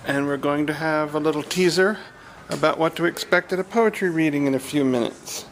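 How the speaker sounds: loud, measured announcement with long pauses; announcer introduction. Real, not acted. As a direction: announce the event loudly, piece by piece. Opens the recording as announcer.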